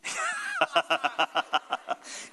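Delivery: real burst of high giggling; high-pitched laugh. Real, not acted. Laughs at own [name] joke.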